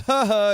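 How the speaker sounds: a short, loud, high ha ha; mock dismissive laugh. Shown as a demonstration. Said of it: "more of a thing that you're like".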